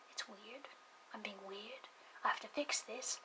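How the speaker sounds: very soft, near-whispered inner voice; retold self-conscious thought. Kid realising they sound weird, hushed. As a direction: say it under your breath to yourself.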